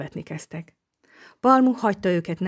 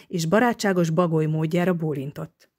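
usual speaking voice

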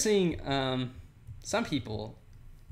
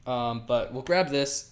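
usual speaking voice

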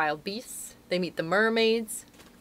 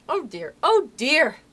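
slow, raised exasperated lament; sighed; 7 semitones higher, 7 dB louder, slower than usual. A narrator's reading. Wendy to the interrupting children, sighing in exasperation. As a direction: sigh it out, louder the second time. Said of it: "sighed Wendy".

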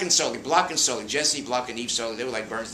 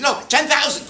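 very high, loud retort with a laugh; mock snappy old star; sudden sharp correction; 13 semitones higher, 10 dB louder than usual. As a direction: snap back the punchline.

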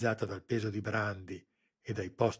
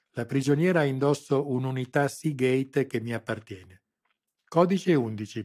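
ordering; 4 semitones higher, 8 dB louder than usual. A narrator's reading. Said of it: "ordinò".

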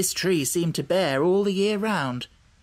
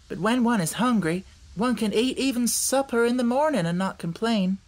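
put-on lightly raised girl's voice, earnest; a hint of a young girl explaining; little girl Dorothy; 7 semitones higher than usual. A narrator's reading. Dorothy explaining the new country to the hen, lightly girlish.

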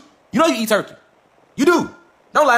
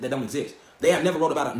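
normal talking voice

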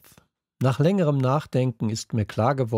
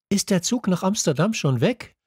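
higher, eager calling voice; called out question; 9 semitones higher than usual. A narrator's reading. Girl calling to Mick at the station, eager. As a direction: call over to a friend in the station. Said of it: "rief mir Betty zu".

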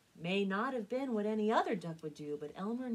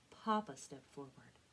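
usual speaking voice

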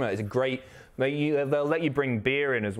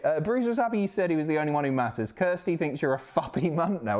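ordinary delivery

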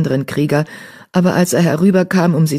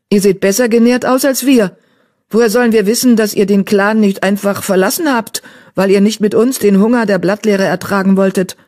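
louder and higher, hostile queen; spitting accusation; 5 semitones higher, 4 dB louder than usual. A narrator's reading. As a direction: spit the accusation at them. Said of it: "fauchte sie". (wütend fauchend)

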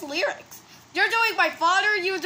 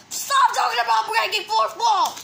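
raised, high exasperated shout; scolding yell; 4 semitones higher, 3 dB louder than usual. Acted. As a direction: yell at them to quit it. Scolding another character in the skit.

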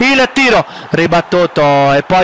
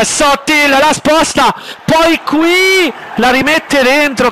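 excited commentary; 6 semitones higher, slower than usual. Acted. Commentary surge.

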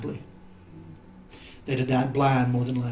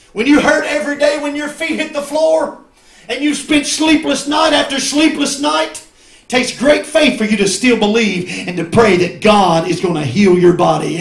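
raised, insistent preacher's cry; full-force preaching; 8 semitones higher, 9 dB louder than usual. Acted. Preacher tells church about praying for healing, loud and urgent. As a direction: build the phrases with loud urgency.

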